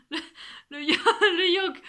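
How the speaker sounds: high, loud, broken up by real laughter; laughing at own slip. Real, not acted. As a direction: try to say it while cracking up. Cracking up at her own slip.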